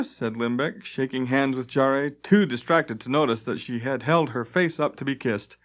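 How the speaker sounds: plain reading voice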